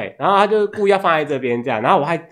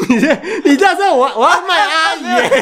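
laughing; 11 semitones higher than usual. Real, not acted. Teased about selling out her aunt.

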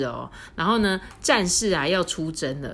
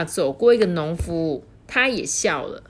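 plain reading voice

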